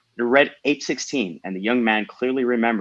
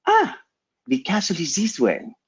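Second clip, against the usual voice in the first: put-on high-pitched voice with French accent; small French girl; 10 semitones higher than usual. A narrator's reading. Girl offers to show the way, high French accent. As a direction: pipe it up as a French child. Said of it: "small girl's voice in a thick French accent".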